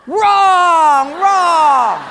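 loud buzzer-like "wrong"; loud rejection. Acted. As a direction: shoot it down hard. Personnel guy rejects answer.

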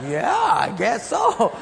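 high, loud, drawn-out mock reluctance; hesitant agreement. Pastor answering unexpected prayer request, comically hesitant. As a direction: stretch it out as a comic unsure yes. Mimics own reply to stranger.

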